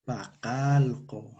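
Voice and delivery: Pacalco said very slowly, syllable by syllable; slow model. Shown as a demonstration. Teacher models Pacalco slowly to class. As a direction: stretch out Pacalco for learners. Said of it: "Repitan conmigo".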